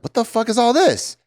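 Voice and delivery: high incredulous mock exclaim; shocked outburst. Daughter visits mom's house and exclaims, high and incredulous. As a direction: act stunned by the house. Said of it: "I'm like".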